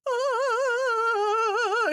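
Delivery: short, very high mock monster shriek; mocking how tame the fun house ghoul probably was, with a high shriek. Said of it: "But it was probably just like".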